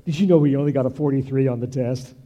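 lightly voiced catty gossip. Preacher mimicking a gossiping student, lightly catty. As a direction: lean in and dish the dirt, half mocking.

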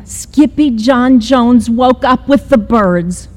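ordinary delivery

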